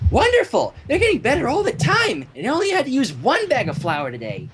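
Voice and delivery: high, cheery pony voice; chirpy and proud; Pinkie Pie voice. A narrator's reading. Pinkie telling Mrs. Cake about the foals, bright and high.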